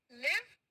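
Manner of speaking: लिव with a short, clear i; short-i live. Shown as a demonstration. Teacher asking children to repeat along, लिव with short i. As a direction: model लिव on a short vowel. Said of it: "मेरे साथ में फिर से बोले".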